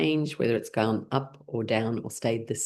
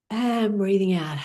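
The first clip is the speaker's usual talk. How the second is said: guiding the exhale; 5 semitones higher than usual. Acted. Guides breathing.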